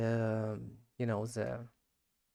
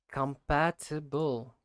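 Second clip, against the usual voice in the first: "Compatible" drawn out syllable by syllable; slow practice; 3 semitones higher, slower than usual. Shown as a demonstration. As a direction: slowly sound out com-PAT-i-ble in "Compatible". Practises the word slowly by syllables.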